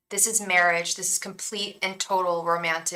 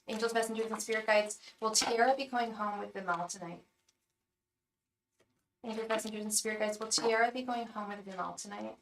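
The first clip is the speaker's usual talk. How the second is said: soft, even incantation; hushed tarot reader's chant; murmured spirit invocation; 10 dB quieter, faster than usual. Acted. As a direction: quietly put the question to the spirits. Repeats the invocation.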